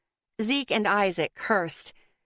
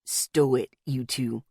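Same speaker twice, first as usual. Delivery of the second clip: low growl; 7 semitones lower, slower than usual. A narrator's reading. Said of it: "Zeke growled at the twins".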